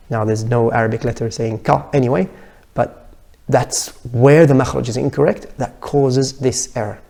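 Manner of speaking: usual speaking voice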